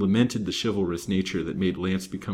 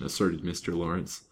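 ordinary delivery

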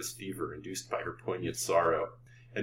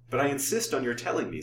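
higher-pitched, mildly commanding insistence; a touch of command; imperious; 5 semitones higher, faster than usual. A narrator's reading. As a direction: insist on an answer. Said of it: "with unconscious imperiousness of tone".

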